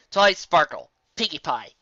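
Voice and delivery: ordinary delivery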